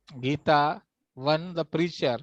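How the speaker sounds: ordinary talk, nothing marked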